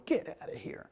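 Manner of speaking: hushed whisper; whispered shoo. Retold or imitated. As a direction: whisper him away. Shooing Gavin away quietly.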